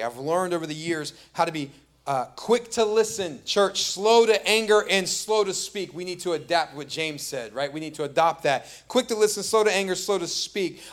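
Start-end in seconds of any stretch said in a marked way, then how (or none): 2.1-5.9 slow, loud, high proclamation; preaching at full force. Acted. Preacher calling the church to James' rule, slow and loud. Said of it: "quoting James emphatically". 8.9-10.8 preaching at full force. Acted. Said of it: "repeats James quote".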